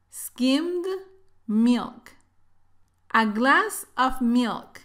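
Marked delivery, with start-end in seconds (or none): none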